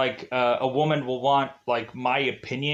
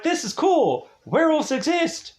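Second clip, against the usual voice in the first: high, gushing mock delight; giddy fake excitement over werewolves; mock thrilled; 11 semitones higher than usual. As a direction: gush as if werewolves are the best news.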